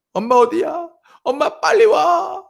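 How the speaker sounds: hammed-up frightened daughter calling for mom; imitating a scared child. Imitating his frightened daughter phoning for mom, high and panicky. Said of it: "울면서 불면서 전화 왔습니다".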